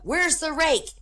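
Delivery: high-pitched call, volume held back; mock yell. Husband calling up to his wife, mock high yell. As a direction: call upstairs in a pretend yell. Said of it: "he yells up".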